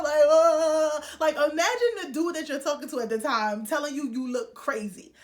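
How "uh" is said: loud, high mock panicked "uh"; hammed-up freak-out noise; freaked-out cry. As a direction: act out a panicky wail. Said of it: "Like, I'm freaking out".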